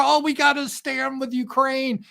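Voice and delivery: high-pitched mock concern; mock earnest plea. Host mimicking heartland America fretting over Ukraine, mock earnest. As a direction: put on a high, pleading voice. Mocks supposed heartland sentiment.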